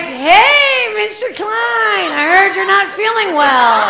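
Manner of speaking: sing-song customer service voice to a dead man; forced cheer. Retold or imitated. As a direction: chirp at him as if he's fine. Fake-cheerful to dead passenger.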